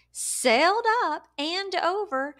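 ordinary delivery

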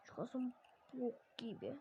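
fısıldayarak, gizlice söyle; fısıltı. Real, not acted. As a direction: sesi tamamen kıs, fısılda. Muscle joke, whispered aside. (fısıltıyla söylenen şaka)

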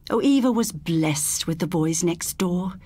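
ordinary delivery

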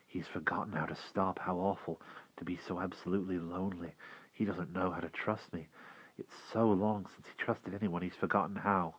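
soft, hushed pity; inner thought. Acted. Will thinking about the lonely old man, hushed. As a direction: murmur it to yourself, sadly.

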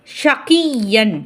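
ஷகியன் with the ய held long and pressed; pressed doubled y. Shown as a demonstration. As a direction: say ஷகியன் slowly, leaning hard into a doubled y. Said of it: "யாவை அழுத்தணும்".

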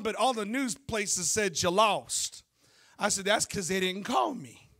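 emphatic sermon delivery with punched key words; bold, declarative pulpit voice; preaching. Real, not acted. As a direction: preach it to a congregation, drawing out line endings. Preaches in all lines.

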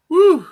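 loud high whoop of pain; whooping shout. Real, not acted. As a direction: let out a wild woo. Reacting to the heat.

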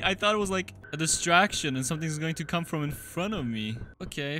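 ordinary talk

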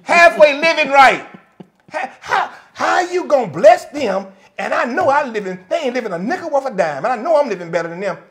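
high, loud mocking indignant voice; jealous churchgoer. Pastor mocking jealous believers, high indignant mock. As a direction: lampoon a resentful saint, voice pushed up.

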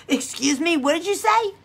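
laughing, high baffled question; playfully confused by a Southern saying; baffled huh. Retold or imitated. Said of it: "And I'll be like".

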